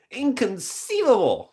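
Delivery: high, slow, indignant Inconceivable; Vizzini quote. Retold or imitated. Streamer quoting The Princess Bride, high and drawn out. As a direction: stretch out Inconceivable in outrage. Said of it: "But the Inconceivable".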